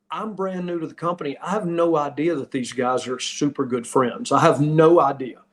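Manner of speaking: ordinary talk, nothing marked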